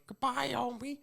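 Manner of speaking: high Marge goodbye; a quick Marge impression. Podcaster repeating Marge's goodbye, high impression. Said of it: "Marge going".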